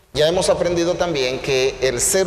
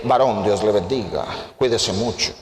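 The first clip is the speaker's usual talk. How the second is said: low, put-on pious voice; mock religious speech; 8 semitones lower than usual. Shown as a demonstration. Preacher mimicking people who feign spirituality, low pious tone. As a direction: greet him with affected holy solemnity. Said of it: "hablando religiosamente".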